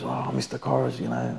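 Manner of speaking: mimicking service bloke. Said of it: "the service bloke goes".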